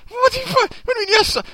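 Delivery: very high, sputtering disbelief; hammed-up baffled protest; acted incredulity. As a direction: throw the question back in an exasperated squeak. Acts disbelief at others' indifference.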